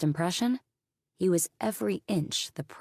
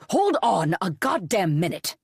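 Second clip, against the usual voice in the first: louder, sharp-edged interruption; 4 semitones higher, 7 dB louder than usual. Acted. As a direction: cut in with a hard edge. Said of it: "a sharp edge to his voice".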